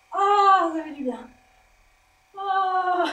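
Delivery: high, loud sighs of relief; blissful moans. Real, not acted. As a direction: sigh into the pleasure, then giggle. Moaning from massage gun.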